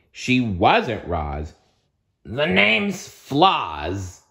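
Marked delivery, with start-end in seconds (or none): 2.2-4.2 very slow, menacing growl; slow monster growl, higher. Acted. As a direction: draw out the name like a threat. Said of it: "the monster growled".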